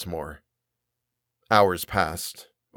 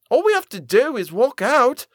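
high-pitched, heated urging; fiery insistence; 4 dB louder than usual. A narrator's reading. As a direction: push it like a defiant girl. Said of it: "Violet's eyes burned".

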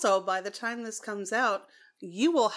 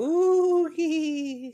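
excited hoot; 9 semitones higher, 8 dB louder than usual. Real, not acted. Reaction to vampire ball.

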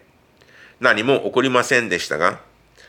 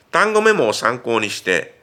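ordinary delivery

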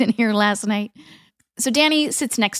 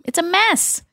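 exclaiming; 8 semitones higher than usual. Retold or imitated. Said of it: "Danny exclaims".

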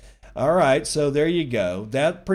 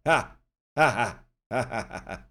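gloating ha ha ha laugh; mocking laugh. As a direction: laugh at their losses. Mocking liquidated shorts.

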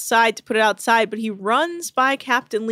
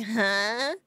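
softer, mock-drunk "huh"; wasted Lauren; 6 dB quieter than usual. Drunk crew member who missed the fire, vague mock-drunk voice. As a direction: imitate a tipsy, clueless reply. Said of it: "you do a good Lauren".

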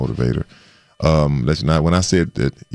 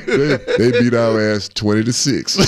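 laughing; 10 semitones higher, slower than usual. Real, not acted. Laughing at the punchline.

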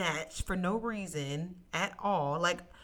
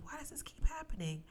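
high, breathless whisper; whispered despair; anguished whisper; 9 semitones higher, 6 dB quieter than usual. Retold or imitated. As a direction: let the question fall away into a whisper.

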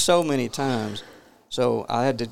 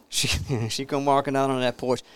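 ordinary delivery, nothing marked

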